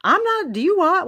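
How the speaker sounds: high, loud mock exasperation; hammed-up indignant outburst; fed up. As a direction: protest like a fed-up ruler being imitated. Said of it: "gets fed up".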